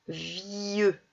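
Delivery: "vieux" slowly, long i gliding into eu; drawn-out syllable. Shown as a demonstration. Teacher repeating the word to single out its sound, drawn out. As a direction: stretch the i in "vieux". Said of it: "J'entends ieux".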